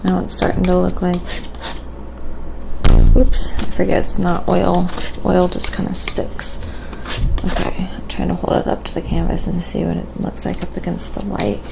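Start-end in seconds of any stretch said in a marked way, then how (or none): none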